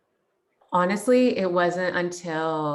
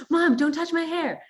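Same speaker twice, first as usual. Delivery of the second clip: higher, louder mock-annoyed protest; retold snap at mom; 11 semitones higher, 4 dB louder than usual. Adult daughter telling her mom off about her hair, high and loud. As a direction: push mom away, irritated and raised. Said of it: "And I was like".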